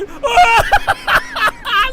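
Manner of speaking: shrieking high loud laughter; real screaming laughter; hysterical laugh. Real, not acted. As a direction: lose it completely.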